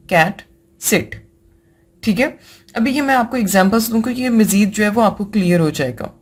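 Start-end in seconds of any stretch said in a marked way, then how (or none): 0.1-1.2 कैट, सिट said slowly with short clipped vowels; short vowel demo. Shown as a demonstration. Teacher demonstrating short vowels to students. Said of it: "simple वो क्या है".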